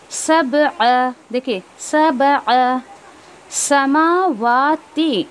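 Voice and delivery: सबअ and समावाती drawn out very slowly; slow tajweed demo. Shown as a demonstration. Teacher demonstrates long vowels for students. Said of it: "दोनों पे खड़ा ज़बर है".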